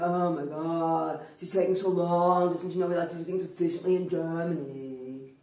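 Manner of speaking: loud, slow, exasperated complaint; huffing and puffing. Retold or imitated. Shoppers queued behind a slow customer, huffing impatiently. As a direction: grumble loudly, drawing out the words. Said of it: "huffing and puffing and".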